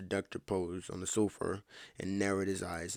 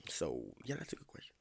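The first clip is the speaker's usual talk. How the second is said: whispered aside; 7 semitones lower, 10 dB quieter than usual. Real, not acted.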